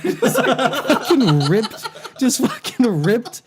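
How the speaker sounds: laughing. Real, not acted. Laughing at the damage.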